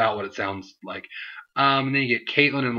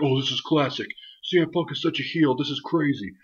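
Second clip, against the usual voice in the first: higher, put-on fan voice; mock gushing fan; 4 semitones higher than usual. Vlogger parodying fans cheering a heel, higher mocking voice.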